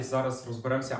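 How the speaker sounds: normal talking voice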